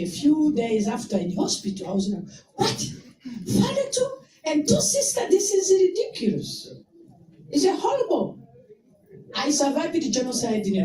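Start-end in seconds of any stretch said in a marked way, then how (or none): 2.6-8.4 much higher, stunned outcry of disbelief; can't believe more have died; shocked disbelief. Retold or imitated. Mourner retelling learning the father also died, stunned and much higher.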